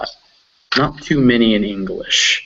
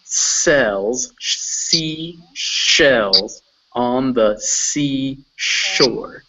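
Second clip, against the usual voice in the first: very slow demo, every sh and s stretched; really emphasize each word, one at a time; slow and emphasized; much slower than usual. Shown as a demonstration. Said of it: "Go a little bit slower".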